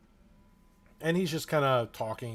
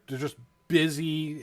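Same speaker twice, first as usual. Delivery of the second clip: normal talking voice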